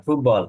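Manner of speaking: ফুটবল said with the t swallowed into the b; teacher showing how to say ফুটবল; football with b sound. Shown as a demonstration. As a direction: say ফুটবল as foob-ball, no t. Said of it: "ফুটবল উচ্চারণটা আমি বলি".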